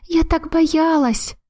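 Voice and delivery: put-on a little girl pouring out her fear; scared child. A narrator's reading. Child clinging, trembling. (детский испуг, выше и медленно)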